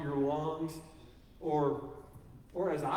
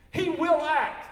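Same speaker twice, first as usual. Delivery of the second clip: loud, high, full-force declaration; emphatic proclamation; 9 semitones higher, 9 dB louder, slower than usual. Real, not acted. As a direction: hammer the promise home. Preaching climax assurance.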